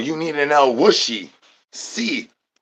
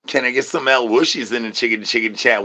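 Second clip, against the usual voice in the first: usual speaking voice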